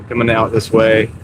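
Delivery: usual speaking voice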